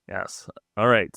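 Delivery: ordinary talk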